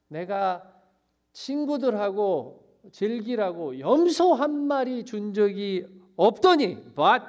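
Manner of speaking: acted aggrieved tirade with hard-hit words; rough, angry. Elder son lists grievances to his father, rough and angry. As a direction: complain loudly and indignantly, pressing each grievance. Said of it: "라고 너무너무 거칠게".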